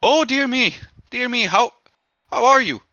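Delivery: high-pitched, fussing lunch lady. Acted. Lunch lady seeing the injured man come in, fussing. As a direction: cluck over an injured regular. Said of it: "she says".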